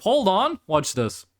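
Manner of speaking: ordinary delivery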